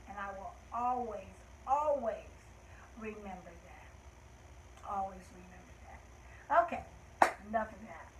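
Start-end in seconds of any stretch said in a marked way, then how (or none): 0.1-6.6 wistful, moved. Real, not acted. Moved by memory of deceased.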